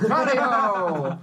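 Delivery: rallying cry. Acted. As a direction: call the charge with gusto. Charging through the door.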